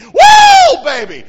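very high, very loud whoop; a joyful preacher's whoop. Real, not acted. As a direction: let out a wild cheer.

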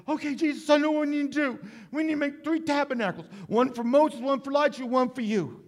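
high, loud babbling Peter voice; goofy Peter. Retold or imitated. Preacher voicing Peter's goofy plan to Jesus, high and loud. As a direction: blurt it out like a half-asleep fool. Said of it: "a real goofy statement here".

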